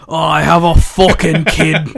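loud, hammed-up Irish accent; put-on Irish brogue, exclaiming; Becky's Irish accent. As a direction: belt out a caricature Irish accent. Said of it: "can I do Becky?".

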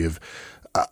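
ordinary delivery

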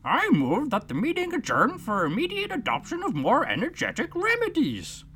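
high, pompous dodo; a bird making a formal motion; solemn dodo. Acted. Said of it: "solemnly rising to its feet".

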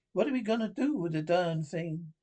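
ordinary delivery

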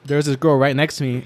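usual speaking voice

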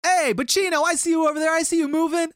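put-on high, loud drunken teasing; drunk taunting call. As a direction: sing it at him like a wasted guy who won't quit. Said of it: "He goes".

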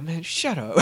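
high-pitched mock annoyance; irritated retort. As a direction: brush off the compliment, playfully irritated. Character snaps back at compliment.

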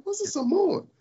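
very high baffled country bumpkin; country boy voice. Retold or imitated. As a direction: squeak it like a clueless kid from the country. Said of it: "from the country".

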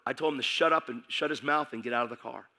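usual speaking voice

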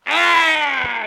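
sharp startled shout; shocked cry. Acted. Shot fired, Carver cries out.

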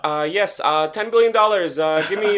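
hammed-up pitch of a powerful chairman buying a match; communist chairman. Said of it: "Imagine being this communist, oligarch, chairman person".

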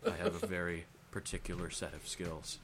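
low, menacing movie line; deadpan thriller villain threat; Liam Neeson impression.

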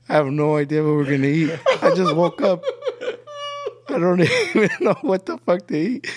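high, laughing mock protest; exaggerated exasperation. Just-woken grandson asked about food, exaggerated high-pitched exasperation. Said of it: "I wasn't talking like this".

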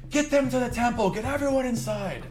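high-pitched mock shout of a guard; acted distant crowd-control yell. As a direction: call out urgent orders, pitched high, without full volume. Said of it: "some of the guards shouting".

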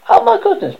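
high-pitched, loud astonishment; shocked exclamation. Acted. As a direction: exclaim in acted shock at the discovery. Pigs gasp at the realization.

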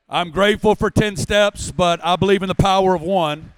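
preacher booming his conviction to the congregation; preaching at full force. Acted. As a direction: drive the line home to the crowd. Preaching climax.

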